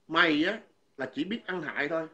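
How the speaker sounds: ordinary talk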